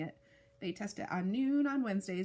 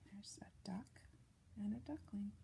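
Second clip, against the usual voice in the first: put-on hushed whisper to the child; 12 dB quieter than usual. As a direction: whisper it like a secret. Whispers picture description aside.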